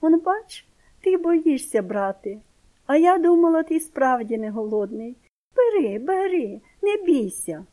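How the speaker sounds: high, loud mock-sweet coaxing aunt; sweet on the surface, mocking beneath; sugary sweet voice. A narrator's reading. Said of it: "таким солодким голосом каже". (солодкий глузливий голос тітки)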